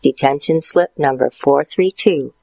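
ordinary delivery

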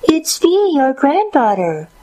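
high put-on little-girl voice; a crafty impostor mimicking a girl, very high; fake young girl. A narrator's reading. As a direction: pretend to be a granddaughter in a high pitch. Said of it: "doing his very best to sound like a young girl".

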